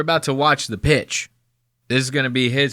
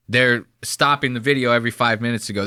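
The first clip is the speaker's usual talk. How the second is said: usual speaking voice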